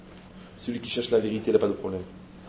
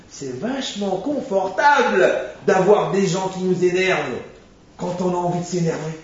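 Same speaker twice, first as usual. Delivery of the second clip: sarcastic; 5 semitones higher, 4 dB louder, slower than usual. Acted. Ironic remark on anger.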